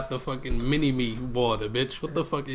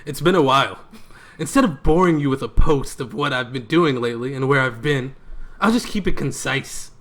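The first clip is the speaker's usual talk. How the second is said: mock dramatic reading; 4 dB louder than usual. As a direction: read it out like a grand speech, tongue in cheek. Reads the Snapchat post aloud.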